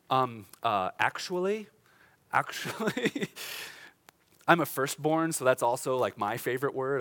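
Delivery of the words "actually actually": lightly voiced know-it-all, rising; a hint of a correcting pedant; pedantic scribe. Preacher mimics the scribes' favorite word, lightly pedantic. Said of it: "The, the favorite word of the scribe is".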